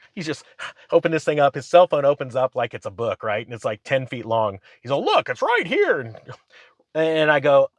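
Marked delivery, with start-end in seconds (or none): 5.0-6.0 much higher, eager pointing out; excited friend. Retold or imitated. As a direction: show it off like a kid. Friend showing proof on phone.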